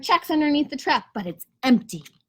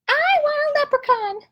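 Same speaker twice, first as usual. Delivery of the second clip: much higher, small squirrel voice; Squirrel's voice. Acted. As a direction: squeak it out like a little squirrel. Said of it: "Squirrel says".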